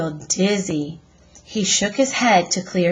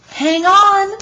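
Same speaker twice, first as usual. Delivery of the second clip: excited title; 12 semitones higher, 8 dB louder than usual. A narrator's reading. Dramatic chapter title read out.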